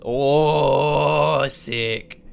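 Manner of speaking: loud, long drawn-out "oh"; big admiring ooh; impressed exclamation. Real, not acted. Reacting to the company logo.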